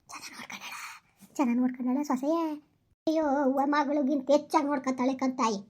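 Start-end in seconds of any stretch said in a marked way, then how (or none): 0.1-0.7 very soft, fully whispered question. Acted. Asking after the daughter-in-law's care, whispered. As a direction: whisper it under your breath. Line whispered, then said aloud.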